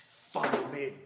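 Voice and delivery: kids' imitation of an earnest film line; imitating Jesus. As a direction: playfully copy an intense movie summons. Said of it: "imitating that and doing it to one another".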